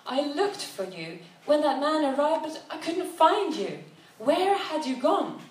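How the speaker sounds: lightly voiced hurt traveller; on the edge of tears; distressed young woman. Upset woman confronts her guide.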